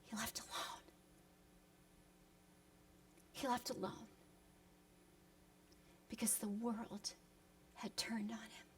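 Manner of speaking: hushed unvoiced whisper; barely there whisper of loss. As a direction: let it fall to a whisper, grieving. Sermon climax hushed.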